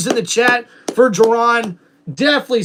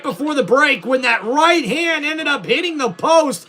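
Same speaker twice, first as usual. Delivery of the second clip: higher, excited wrestling commentator call; play-by-play commentary; 6 semitones higher than usual. Acted. Streamer calling the wrestling game for chat, excited play-by-play. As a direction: call the match like a TV announcer.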